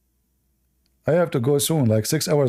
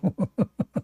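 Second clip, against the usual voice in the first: laugh; 6 semitones higher, 4 dB quieter than usual. Real, not acted. Laughs at own joke.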